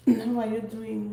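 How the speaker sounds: ordinary talk, nothing marked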